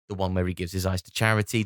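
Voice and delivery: ordinary delivery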